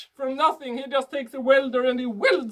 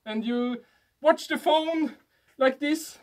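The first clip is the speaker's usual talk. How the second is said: ordinary talk, nothing marked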